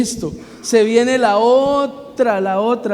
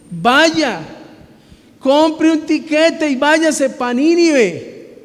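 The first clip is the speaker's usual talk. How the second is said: higher and slow, commanding; voice of God; 6 semitones higher, much slower than usual. Retold or imitated. The Lord sending Jonah to Nineveh - commanding. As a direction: order him off like an impatient boss.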